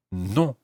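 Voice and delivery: "non" with a nasal French vowel. Shown as a demonstration. Teacher introduces the French for no, "non" with nasal vowel. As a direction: say "non" through the nose, final n unsounded. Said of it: "quite a nasal sound".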